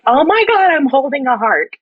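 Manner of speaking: high, gushing amazement; naive awe. Retold or imitated. Naive helper first feels a beating heart, high awestruck gush. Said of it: "naivete, I was like".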